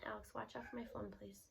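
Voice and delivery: hurried soft aside; quiet aside. Real, not acted. Narrator aside to child.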